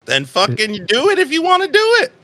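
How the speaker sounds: high-pitched impatient outburst; exasperated outburst. Real, not acted. Game master goading a hesitant player, exasperated.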